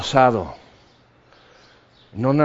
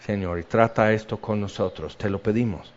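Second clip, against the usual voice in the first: heartfelt prayer; 3 semitones lower than usual. Acted. Pastor praying with the standing congregation, hushed and grateful.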